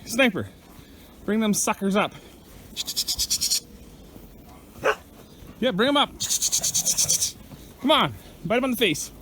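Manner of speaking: high-pitched call to the dog to push the bulls. Real, not acted. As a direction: egg the dog on from across the pen. Urging the dog to move bulls.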